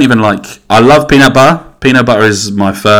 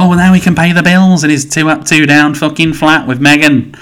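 mocking high sing-song, pitched much higher; 7 semitones higher than usual. Mimicking people defending Harry, high mocking voice. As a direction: parody the gushing public. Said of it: "everyone's like".

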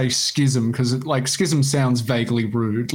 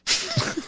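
breathless silent laugh; 6 dB quieter than usual. Real, not acted. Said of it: "laughing at Greg joke".

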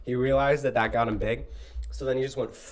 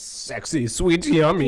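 rising louder ad tagline; perfume ad voice; 3 semitones higher, 6 dB louder, much slower than usual. Acted. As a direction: sell it like a perfume ad.

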